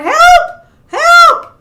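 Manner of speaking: high, loud storybook shouts; cry for help. Acted. Trapped fish call out to the shark, shrieking for help. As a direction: yell for rescue in a high voice. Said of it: "all of a sudden he heard".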